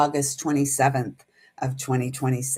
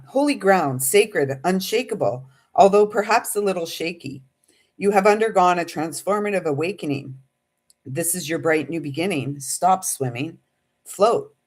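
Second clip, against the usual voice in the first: channeled message reading; 3 semitones higher than usual. Acted. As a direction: intone it like a message from spirit.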